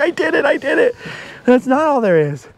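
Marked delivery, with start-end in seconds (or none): 0.0-1.0 mock triumphant cheer. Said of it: "I'd be like".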